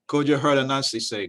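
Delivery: plain reading voice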